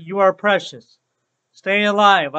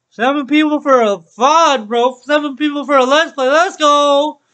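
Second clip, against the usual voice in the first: excited cheer; 5 semitones higher, 6 dB louder than usual. Real, not acted. Excited about viewer count.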